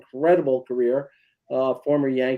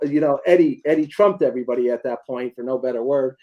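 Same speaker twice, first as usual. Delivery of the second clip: normal talking voice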